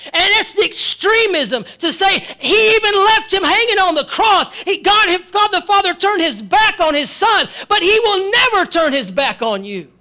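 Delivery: loud, high, driving preacher's cry; preaching at full force. Acted. Preacher shouting to the congregation, full force preaching. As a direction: hammer it home to the congregation.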